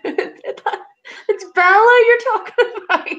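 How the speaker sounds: correcting a viewer, laughing. Real, not acted.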